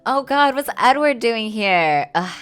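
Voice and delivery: ordinary talk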